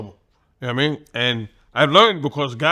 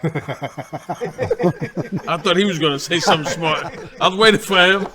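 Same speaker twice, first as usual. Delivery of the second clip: laughing. Real, not acted. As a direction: laugh as you tell it. Laughing while retelling the sparring.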